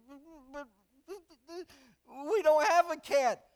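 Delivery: mildly voiced puzzled wife, drawn out; baffled wife.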